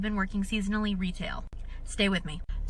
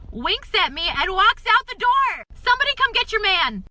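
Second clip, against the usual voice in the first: loud, high, outraged storytelling climax; hollering the punchline; exasperated shout; 12 semitones higher, 9 dB louder than usual. Real, not acted. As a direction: build to a high shout of comic disbelief.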